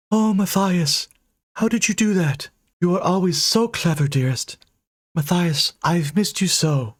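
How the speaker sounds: high, doting specter voice; booming ghost. Acted. Ghost answers her beloved dreamily. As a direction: coo it dreamily.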